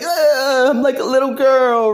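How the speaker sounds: high loud whimper; hammed-up high sob; whiny crying. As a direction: whine it like a crybaby. Superman whining, crying.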